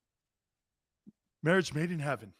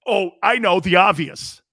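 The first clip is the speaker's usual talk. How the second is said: ordinary delivery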